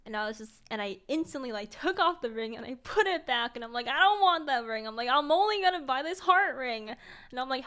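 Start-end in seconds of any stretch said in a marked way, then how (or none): 3.9-4.9 loud, higher-pitched refusal; retold outburst, putting your foot down; firm refusal. Snapped to senses, refuses the ring. 5.1-6.9 loud, higher-pitched declaration; retold outburst, standing your ground; firm insistence. Insists on buying only one.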